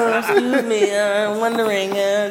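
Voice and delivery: mock meek asking, half laughing; hammed-up shy request; timid, hesitant man. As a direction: stammer like a nervous guy asking permission. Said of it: "you ain't all that asking".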